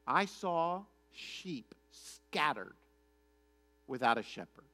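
very slow, spaced-out pronouncement; solemn prophecy. Retold or imitated. Micaiah tells the king his true vision, solemn and slow. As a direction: intone it gravely, with long pauses.

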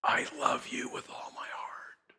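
slow, very soft whisper; whispered divine voice. Retold or imitated. God's voice to a listening woman, softly whispered. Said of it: "The voice said".

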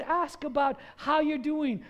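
normal talking voice

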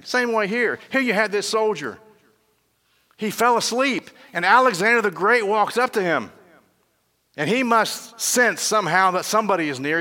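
fervent sermon delivery; preaching at full force. Acted. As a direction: drive the story home from the pulpit. Preacher building story climax.

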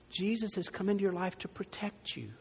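hushed whisper of reassurance; a sudden whisper to comfort the congregation. Real, not acted. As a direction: lean in and whisper it. Intimate sermon reassurance.

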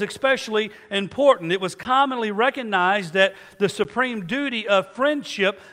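preaching at full force. Acted. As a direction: lift the congregation with emphasis. Preacher rises in intensity.

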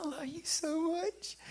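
high, soft, tender "I love you so much"; tender whisper to baby. Retold or imitated. Grandfather cooing love to his granddaughter, tender and soft. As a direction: coo it gently like to a newborn. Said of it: "how I say it".

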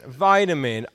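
VITAMIN with a long "eye" first vowel; first syllable of Vitamin rhymes with "tie"; American vitamin. Shown as a demonstration. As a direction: say Vitamin as VY-ta-min, American style. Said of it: "You say vitamin, I say vitamin".

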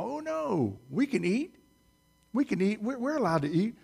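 high, eager mock protest; Eve protesting. Preacher voicing Eve answering the serpent, high and eager. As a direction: play Eve rushing to reassure herself.

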